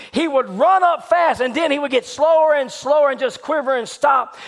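high, driving sermon storytelling; full-force preaching. Retold or imitated. Preacher retelling the serpent striking at the friend, urgent and high. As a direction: preach the attack with mounting urgency.

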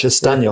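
"Estaño" said in Spanish, es-TAN-yo; Spanish pronunciation of "Estaño", stress on TAN; Spanish word. Shown as a demonstration. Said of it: "Maybe I said it in Spanish".

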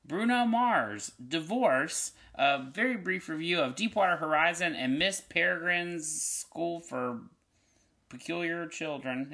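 bright, high announcer energy; podcast host intro. Real, not acted. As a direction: pitch up and sell the show.

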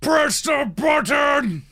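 very high, loud call to allies; strained battle cry; urgent rallying shout. Acted. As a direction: yell the order over a struggle, drawing it out. Tonkus calls out to allies.

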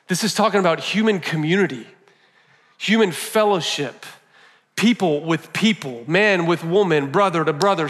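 ordinary delivery

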